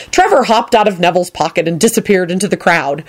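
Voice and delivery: usual speaking voice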